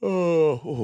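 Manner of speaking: words stretched by a yawn. Real, not acted. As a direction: say it mid-yawn, mouth wide. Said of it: "sorry about the yawn".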